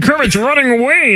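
lightly voiced Kermit the Frog. Shown as a demonstration. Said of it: "here's my Kermit".

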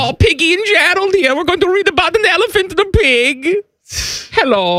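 very high cartoonish German storyteller; German accent. Dad's bedtime story for Samantha, hammed-up German accent. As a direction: pitch it way up in a comic German accent. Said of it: "the German accent when it's story time".

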